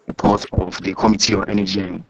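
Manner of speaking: choppy, distorted call audio; words breaking up over the line; scrambled voice. Real, not acted. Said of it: "Your line is still breaking".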